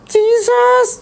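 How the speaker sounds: put-on high, slow child puppet voice; puppet boy voice. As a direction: play the cheeky little boy puppet.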